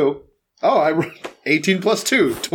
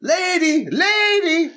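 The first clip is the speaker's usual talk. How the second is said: calling out; 12 semitones higher, much slower than usual. Acted. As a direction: call her over across the clearing. Calling the dog over.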